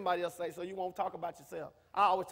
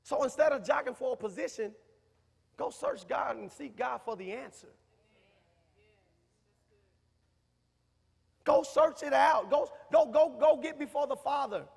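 emphatic preacher's urging, louder; preaching; 2 semitones higher, 6 dB louder than usual. Acted. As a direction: push them to go seek God. Urging congregation to seek God.

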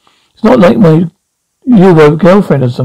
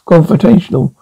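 usual speaking voice

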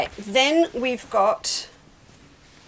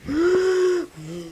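sharp high gasp of real delight; 9 semitones higher than usual. Real, not acted. Quilter gasping over the fabric colours, high delighted gasp. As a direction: catch your breath at how pretty it is. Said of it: "colour therapy for the morning".

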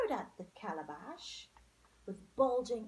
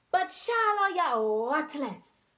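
loud, higher scolding of Shallow; 4 semitones higher, 12 dB louder, slower than usual. A narrator's reading. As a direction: scold him, slow and loud.

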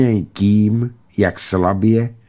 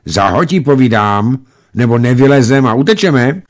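insistent shout; 5 semitones higher, 5 dB louder than usual. A narrator's reading. Boys repeat shouted order.

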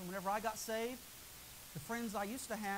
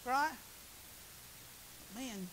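ordinary talk

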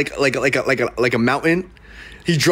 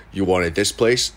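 slow low agent voice; 8 semitones lower, slower than usual. Retold or imitated.

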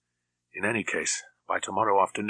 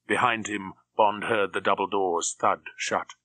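ordinary delivery

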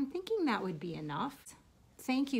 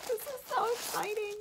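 much higher giddy voice ending in a laugh; giddy squeak into a laugh; excited; 13 semitones higher, 5 dB louder than usual. Real, not acted. Unwrapping a chocolate swap bag, she squeals with excitement. Opening many wrapped presents.

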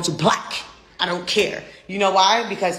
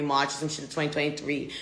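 usual speaking voice

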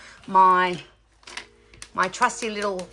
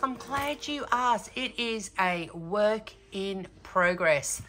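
slow, softer, drawn-out reveal; slow, hushed, playful tease; slow teasing reply; 6 dB quieter, slower than usual. Real, not acted. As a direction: savour it like a little secret.

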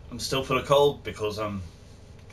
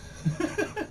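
high giggle; 5 dB quieter than usual. Real, not acted. Laughs at sneeze joke.

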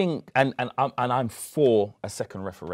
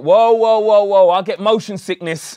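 loud protest; 6 semitones higher, 9 dB louder than usual. Retold or imitated. Family member panicking over the boat.

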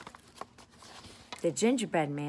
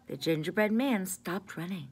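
usual speaking voice

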